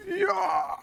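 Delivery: drawn-out high-pitched "yah" attack cry; battle cry. Pretend. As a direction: let out a high yell as you strike. Battle cry during attack in game.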